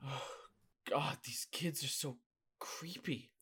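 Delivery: very soft, low remark; hushed unease. Acted. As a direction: murmur it, creeped out. Hushed reaction after chase.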